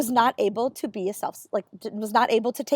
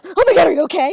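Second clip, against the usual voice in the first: startled mock gasp, higher and louder; alarmed; 5 semitones higher, 8 dB louder, faster than usual. Dermatologist startled by a tic, alarmed and loud. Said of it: "again, she goes".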